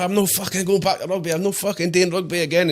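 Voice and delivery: high-pitched retold protest to wife; exasperated vow. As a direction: recreate a fed-up, high-voiced refusal. Acts out vowing to quit rugby.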